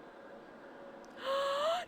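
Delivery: high, long drawn-in gasp; breathless rising gasp; rising shocked gasp. Real, not acted. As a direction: gasp with dawning horror. Realizes she guessed right.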